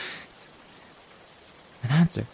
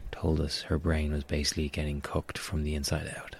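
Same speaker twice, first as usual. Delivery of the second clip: much softer, breathy whisper; hushed whisper; 6 semitones lower, 8 dB quieter than usual. Acted. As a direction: whisper the horror of what she went through. Recalling doctor's words.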